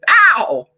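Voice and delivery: loud, high sudden yelp; sharp yelp of mock outrage. Real, not acted. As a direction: yelp as if caught out.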